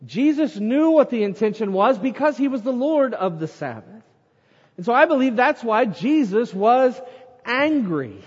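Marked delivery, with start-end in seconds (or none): none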